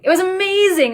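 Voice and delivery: delighted outburst; very excited. Real, not acted.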